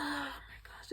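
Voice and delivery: slow breathless whisper; stricken whisper. Real, not acted. Host undone by the scene, slow whisper. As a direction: hush it out, overwhelmed. Overwhelmed by sadness.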